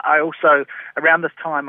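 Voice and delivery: ordinary delivery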